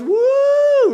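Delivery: high, loud party cheer; mock party whoop. Pastor mocking an anything-goes culture with a whoop. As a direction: whoop like a carefree partier.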